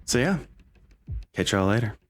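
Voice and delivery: ordinary talk, nothing marked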